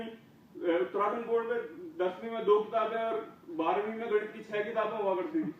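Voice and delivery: normal talking voice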